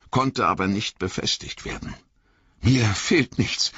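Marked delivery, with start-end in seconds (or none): 2.6-3.7 panting. A narrator's reading. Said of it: "keuchte Frodo".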